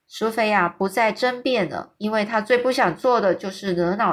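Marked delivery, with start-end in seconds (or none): none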